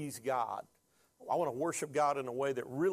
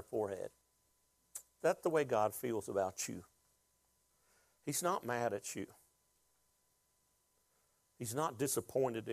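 emotional; 3 semitones lower than usual. Real, not acted. Preacher comforting the congregation with a father's love, low and tender. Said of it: "I'm getting emotional right now".

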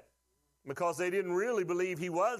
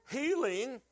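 much higher, slowing on every sickness; rising preacher's cry; 9 semitones higher, slower than usual. Acted. Pastor answers his question to the church, high triumphant cry. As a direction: proclaim it triumphantly. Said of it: "what did Jesus go around doing?".

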